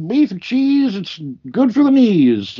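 high rhyming food call; order caller. Retold or imitated. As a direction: holler it in rhyme. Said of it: "Or she'd rhyme the food".

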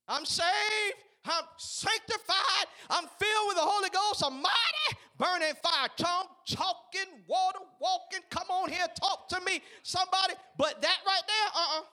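high, loud, shouted church testimony; preaching at full force. Acted. Pastor rousing the church, shouting his testimony. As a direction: call it out, rousing the congregation.

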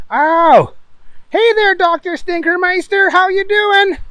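high, loud cheery greeting; cheerful Booger voice. Acted. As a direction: greet her brightly.

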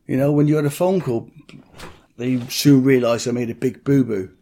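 usual speaking voice

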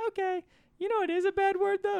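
put-on very high little-kid voice; Milo. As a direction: imitate a small child earnestly correcting grown-ups. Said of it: "He's like".

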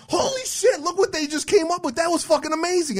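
mock fan excitement. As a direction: ham up the starstruck follower. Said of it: "And everyone goes".

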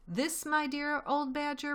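a proud fox showing off his find; Mr Fox's voice. Acted. Mr Fox proudly presenting the storehouse, lightly voiced.

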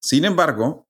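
ordinary talk

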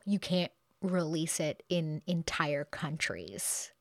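slow, voiceless whisper of curious moviegoers; slow conspiratorial whisper of a tempted audience; mock whisper. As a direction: hush it like a scandalous secret. Curious people quoted, hushed.

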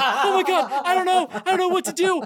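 high-pitched helpless wail; acted-out frantic whimpering; mock panic. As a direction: imitate a kid falling apart. Acts out David panicking.

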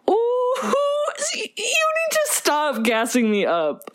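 high squeal, laughing through it; laughing mock gush. To a friend who praised the impression, high laughing squeal. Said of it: "my [name] impression".

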